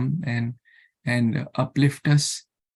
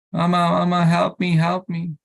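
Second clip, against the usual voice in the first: hammed-up fake child's wail, higher and louder; fake crying child; 5 semitones higher, 4 dB louder than usual. Greedy child fakes crying to get laddu, hammed-up wail. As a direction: put on a child's false cry for a sweet. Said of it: "So he makes a false cry".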